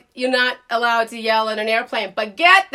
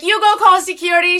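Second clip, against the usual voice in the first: defiant shout; 5 semitones higher, 5 dB louder, faster than usual. Retold or imitated. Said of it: "I was like".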